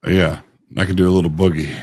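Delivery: ordinary delivery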